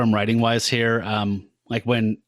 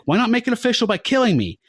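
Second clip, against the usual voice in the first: defiant challenge; 11 semitones higher than usual. Retold or imitated. Character defiantly challenging a demon.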